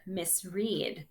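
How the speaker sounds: ordinary delivery, nothing marked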